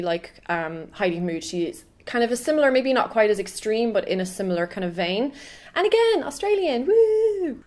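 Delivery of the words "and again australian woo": high, bubbly cheer for another Australian; excited cheer. Real, not acted. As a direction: squeal a little woo for Australia.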